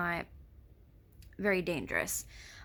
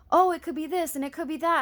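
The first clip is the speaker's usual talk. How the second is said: higher and louder, eager and bubbly; excited self-quote; 8 semitones higher, 5 dB louder, faster than usual. Retold or imitated. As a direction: mimic your own giddy rush of ideas. Quoting her own manic excitement.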